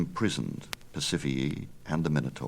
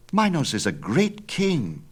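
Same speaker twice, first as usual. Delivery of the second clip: put-on higher, bold young son; eager young Icarus; 10 semitones higher, 3 dB louder than usual. Son argues with his father, higher and bold. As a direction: push back, higher and bold.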